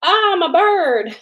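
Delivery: high, loud, slowed mock robot voice; robot pretending to be a bird. Grackle mocked as a machine pretending to be a bird, mock robot squeal. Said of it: "Like a robot machine being like".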